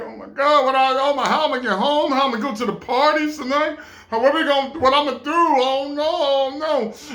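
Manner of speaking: mock panic over a lost ID; panicking. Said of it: "I'm panicking".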